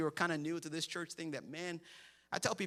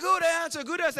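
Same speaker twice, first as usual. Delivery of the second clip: forced cheer; 8 semitones higher, 6 dB louder than usual. Retold or imitated. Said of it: "And they're like".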